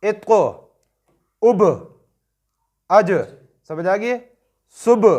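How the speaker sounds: इत्को, उब, अज, सुब each ending with a sharp echoing jolt; qalqala bounce. Shown as a demonstration. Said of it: "blue colour कलकले के लिए".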